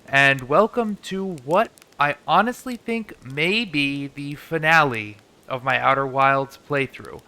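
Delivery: ordinary talk, nothing marked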